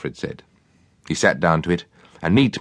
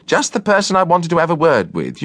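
imitating a gracious lady; 12 semitones higher, 3 dB louder than usual. Cook imitates Miss Cartell.

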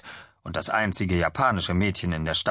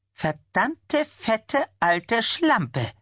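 much higher, spat-out insult; spiteful curse; much slower than usual. Acted. Tammy silently curses the woman, high and spiteful. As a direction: spit each word with contempt.

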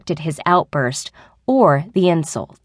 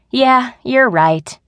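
slow, higher, reluctant concession; sighing agreement; 6 semitones higher, 5 dB louder, slower than usual. A narrator's reading. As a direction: concede with a drawn-out sigh in the voice. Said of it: "Becky sighed".

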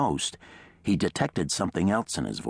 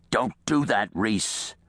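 slightly raised, harsh warning; 4 semitones higher, slower than usual. A narrator's reading. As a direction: warn him with some harshness. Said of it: "a harsh voice".

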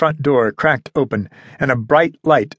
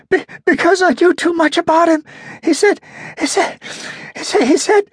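frightened stammer. A narrator's reading. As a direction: stutter it out, scared stiff. Terrified captive stammering.

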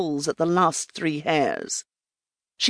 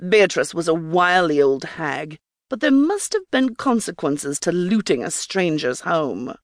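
plain reading voice

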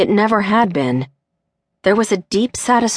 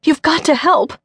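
higher, tearful pleading; panic and tears; 11 semitones higher than usual. Acted. Mother begging Cindy for help, panicked and tearful. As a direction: beg through rising panic. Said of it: "voice was laced with panic and tears".

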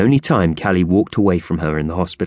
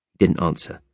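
ordinary delivery

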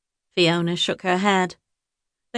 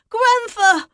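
high, loud alarmed cry; boy crying out; 6 dB louder than usual. A narrator's reading. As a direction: yell in fright as a young boy. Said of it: "cried the boy".

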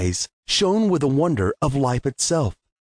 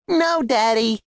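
put-on higher, pleading young daughter; little girl; 8 semitones higher, slower than usual. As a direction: correct your dad like a small girl. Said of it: "said his daughter".

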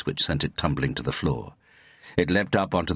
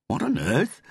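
high-pitched shocked outburst; startled exclamation; 13 semitones higher than usual. A narrator's reading. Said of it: "exclaimed David Elwood".

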